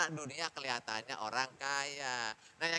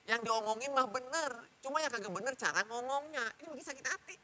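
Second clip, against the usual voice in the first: high-pitched airing of a grievance; complaining child; 7 semitones higher than usual. Acted. Child hurt by how mother talks, complaining. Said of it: "Kata begitu dia ngadu tuh orang-orang".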